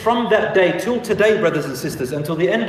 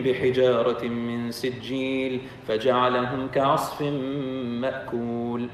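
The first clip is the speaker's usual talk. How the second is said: low, softer, level recitation; Quran recitation; 4 semitones lower, 4 dB quieter than usual. Acted. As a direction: recite the verses evenly in a lowered voice. Recites a surah.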